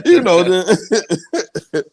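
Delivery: high, laughing exaggeration. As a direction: crack up while telling it. Mock exaggeration while laughing.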